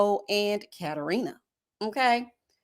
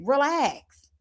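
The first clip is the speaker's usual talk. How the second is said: mock reassuring; 6 semitones higher, slower than usual. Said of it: "And I'm like".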